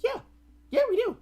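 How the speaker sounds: high and loud, a lying yes; overeager fake reassurance. Man lying to Nora about the pods, a high loud yes. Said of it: "And he's like".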